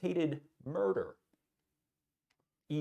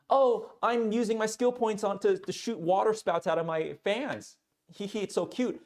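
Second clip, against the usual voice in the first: put-on cute anime girl; 7 semitones higher than usual. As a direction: play it sweet and giggly like an anime heroine. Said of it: "in anime, it's funny 'cause Aqua is".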